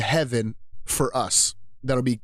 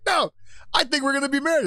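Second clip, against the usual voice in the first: wife's voice; 12 semitones higher, faster than usual. Retold or imitated. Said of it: "She's like".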